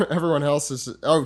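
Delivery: ordinary talk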